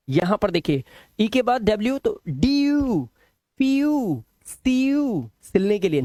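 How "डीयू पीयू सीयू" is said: डीयू, पीयू, सीयू high with a long glide; ee-oo sound. Shown as a demonstration. Teacher reading EW examples, drawn-out डीयू, पीयू, सीयू. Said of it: "इसे पढ़ते यू".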